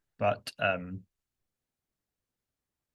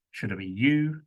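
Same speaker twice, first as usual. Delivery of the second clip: ordinary delivery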